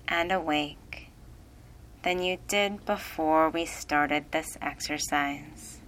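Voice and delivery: ordinary delivery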